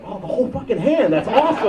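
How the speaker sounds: high-pitched, hammed-up awe; amazed revelation. Kid having a huge revelation from a movie, amazed. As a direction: re-enact a thrilled discovery, voice shooting up. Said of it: "it was a huge revelation to me".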